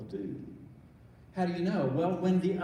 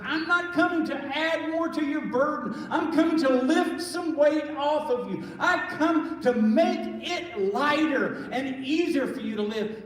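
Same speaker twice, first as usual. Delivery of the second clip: much higher and louder, slowing on "lighter and easier"; fervent Jesus quote; 8 semitones higher, 6 dB louder than usual. Acted. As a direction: lift the promise up high with fervour.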